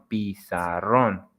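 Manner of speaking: "pizarrón" slowly split, weight on rrón; stress on ron. Shown as a demonstration. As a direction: say "pizarrón" in slow syllables, ending on a firm RRÓN. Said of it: "the strength is on ron".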